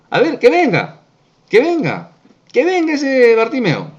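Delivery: high, loud acted summons; calling out. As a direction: call someone over through a crowd. Jesus calling the blind man over.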